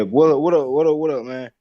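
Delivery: ordinary delivery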